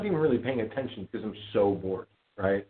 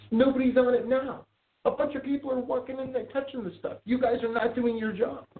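retold scolding; 12 semitones higher than usual. Retold scolding of store clerk.

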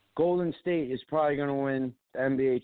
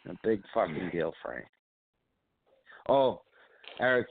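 ordinary talk, nothing marked

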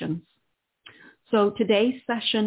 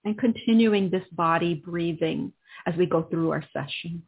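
right in your ear. Acted. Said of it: "guided breathing into body".

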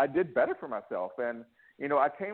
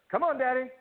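higher, pleading little son; imitating little boy; 9 semitones higher than usual. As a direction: beg like a small boy, pitched up. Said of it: "He's like".

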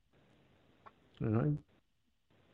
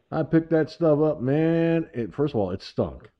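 ordinary talk, nothing marked

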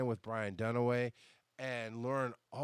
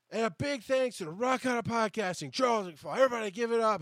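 higher, louder announcer call to the crowd; emcee shoutout; 9 semitones higher, 4 dB louder than usual. Retold or imitated. Brian thanking him onstage, announcer hype.

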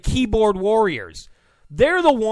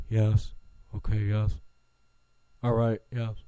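much lower and softer, a timid caving yes; meek mock agreement; 10 semitones lower, 7 dB quieter than usual. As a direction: mumble meek yeses, giving in. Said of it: "And Republicans go".

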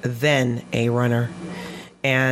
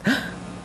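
sharp acted intake of breath; gasp of realization; 11 semitones higher, 5 dB quieter than usual. Runner realizing it is about life, sudden gasp. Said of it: "because I realized".

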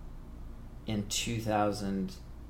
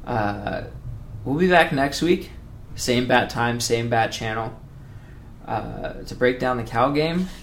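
loud upbeat outro host; podcast sign-off; 2 semitones higher, 6 dB louder than usual. Acted. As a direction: wrap up the show with energy.

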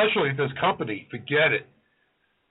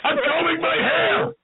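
much higher, slow, mock-innocent answer; innocent mom reply; 10 semitones higher, slower than usual. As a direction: fake an innocent excuse from behind the door.

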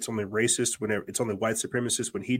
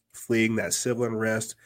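ordinary talk, nothing marked

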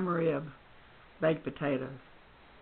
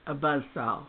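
usual speaking voice

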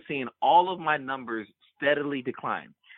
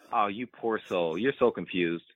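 pitying condescension; 5 semitones lower, 6 dB quieter than usual. Retold or imitated. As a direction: imitate a coach pitying a clueless kid. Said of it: "you can see his face almost saying".

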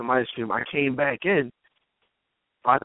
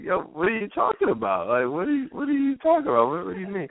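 playing it off; 6 semitones higher than usual. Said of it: "I try to play it off".

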